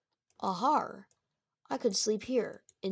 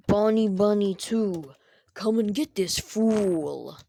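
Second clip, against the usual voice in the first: loud, slow villain order; booming command; 10 dB louder, slower than usual. Acted. Freddy loudly ordering Bonnie to attack Michael. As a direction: bellow the order to your minion.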